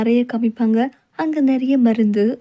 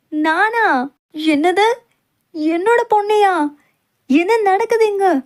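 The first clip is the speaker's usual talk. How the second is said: higher, louder, startled disbelief; outraged shock; 7 semitones higher, 4 dB louder, slower than usual. Acted. Mother shocked her daughter is chosen, loud outrage. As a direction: shout it as the wedding falls apart.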